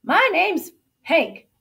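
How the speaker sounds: high, loud, slow introduction; friendly farmhand. A narrator's reading. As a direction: introduce yourself heartily, taking your time. Farmhand Hank introduces himself.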